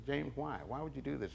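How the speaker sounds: quiet hurt question. Retold or imitated. [name] hurt, questioning thief.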